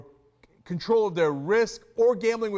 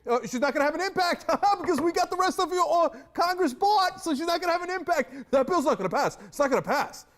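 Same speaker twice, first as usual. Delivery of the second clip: mock anchor voice; 7 semitones higher, 4 dB louder than usual.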